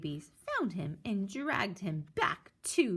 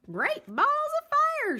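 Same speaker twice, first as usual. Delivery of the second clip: very high loud exclaim; goose crying out; 5 dB louder than usual. Acted. Goose lumbers up to the nest, shrieking high. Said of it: "she cried".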